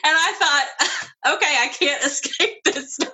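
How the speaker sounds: amused giggle mid-sentence; laughing. Retold or imitated. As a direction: chuckle through the resigned thought. Retelling her own thought, laughing.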